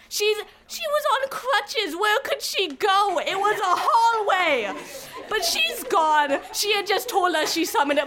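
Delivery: retold hysteria. Telling an audience the guide vanished, comic hysterical outcry. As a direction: wail it in comic, hammed-up panic.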